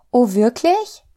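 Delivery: high, loud rising question; delighted surprise. A narrator's reading. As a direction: exclaim it in hopeful amazement. Little soul hears hopeful offer.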